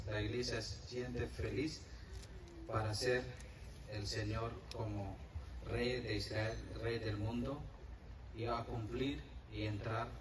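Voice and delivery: ordinary talk, nothing marked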